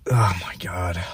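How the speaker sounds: breathy whispered oh my God; a hushed, horrified aside; whispered dismay. Real, not acted. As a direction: whisper it in dread at yourself. Groaning over his own screwup.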